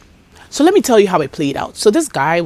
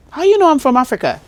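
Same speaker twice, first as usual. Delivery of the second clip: put-on American accent, higher; mock American accent; 8 semitones higher, 4 dB louder, faster than usual. Shop worker mimicking herself in an American accent. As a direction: imitate an American twang, surprised. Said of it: "My American is coming".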